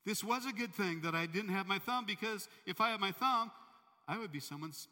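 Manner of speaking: shaken king realizing he was saved; startled realization. Retold or imitated. King alone in the jungle, crying out in relief. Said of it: "walking through the jungle going".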